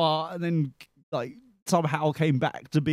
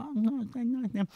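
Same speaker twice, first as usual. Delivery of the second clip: muttering aside; 8 semitones higher, 7 dB quieter than usual. Retold or imitated. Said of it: "It's like".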